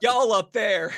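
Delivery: a touch of Southern accent, teasing. Real, not acted. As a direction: slip into a mild Southern twang. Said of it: "have a Southern accent".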